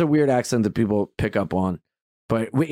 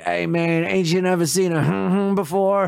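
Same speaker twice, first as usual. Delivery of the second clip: higher-pitched mock beggar; questuante sul treno; 5 semitones higher than usual. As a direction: hum over the censored word. Voices the beggar on the train.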